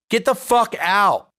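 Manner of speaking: slow, high, raw outburst; angry shout. Acted. Boyfriend snapping at girlfriend while cleaning, angry slow shout. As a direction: lose it and throw her out. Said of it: "I heard myself shout".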